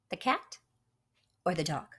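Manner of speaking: ordinary delivery, nothing marked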